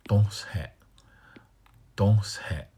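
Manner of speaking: Don't say whispered; whispered demo. Shown as a demonstration. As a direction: breathe Don't say without voice. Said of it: "Don't say versus don't say".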